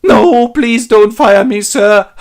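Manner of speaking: high, loud, slow wail; terrified begging. Acted. Cratchit begs Scrooge for his job, wailing. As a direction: wail a drawn-out plea, hammed up.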